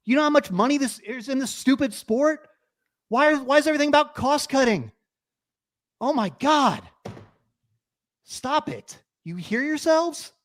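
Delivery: high-pitched exasperated scolding; angry rant. Real, not acted. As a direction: lay into them, incredulous and fed up. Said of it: "I'm so mad right now".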